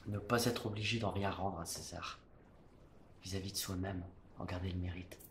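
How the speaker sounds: hushed declamation. Acted. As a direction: bring it down to a proud hush. Proud, inward close of tirade.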